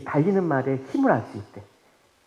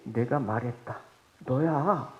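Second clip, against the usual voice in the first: quiet firm answer; 6 semitones lower, 8 dB quieter, slower than usual. Acted. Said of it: "예수님께서 그에게".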